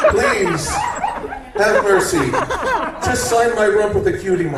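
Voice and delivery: pleading. Acted.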